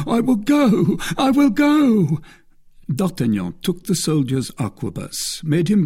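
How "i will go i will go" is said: high, loud, frantic surrender; terrified cry. A narrator's reading. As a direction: give in desperately, stretching the last cry. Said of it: "by force of terror".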